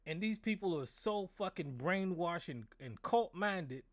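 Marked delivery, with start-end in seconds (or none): none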